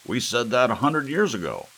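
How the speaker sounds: put-on grumpy old man's scoff; scornful old man. A narrator's reading. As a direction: scoff at the news like an old crank. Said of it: "snorted Gramps".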